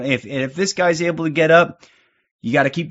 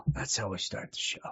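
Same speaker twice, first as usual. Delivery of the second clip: breathy whisper, much softer; 7 semitones lower, 18 dB quieter than usual. Real, not acted. Streamer echoing his own opener to viewers, hushed whisper. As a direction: let it drop to a hushed whisper.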